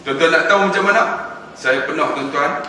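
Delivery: usual speaking voice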